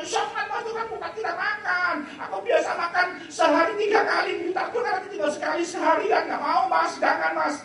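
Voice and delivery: high, pleading wife's voice; a pleading wife, voiced high. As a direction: beg him not to sow the seed. Wife pleading with husband, acted.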